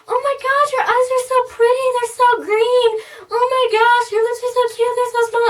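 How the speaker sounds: very high, loud, gushing compliments; overly cheery hairdresser. Retold or imitated. Hairdresser complimenting her client's features, overly cheery. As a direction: gush in a squeaky put-on voice. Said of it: "This is legit her voice".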